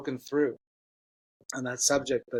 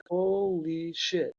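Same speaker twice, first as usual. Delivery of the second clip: very slow, drawn-out "holy", pitched up; stunned realisation; 5 semitones higher, much slower than usual. Retold or imitated. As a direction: stretch it out in retold amazement. Acting out his stunned realisation.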